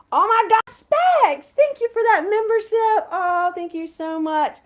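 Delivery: high, loud delighted thanks; thrilled squeal. Real, not acted. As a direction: squeal with joy at the gift.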